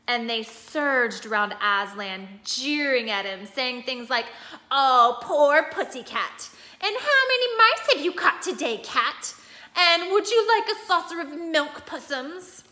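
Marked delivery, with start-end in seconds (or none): 4.7-12.6 higher, louder sing-song mockery; taunting jeer. A narrator's reading. Rabble taunting the bound lion, mock sweet jeering. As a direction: sneer and coo at the helpless cat.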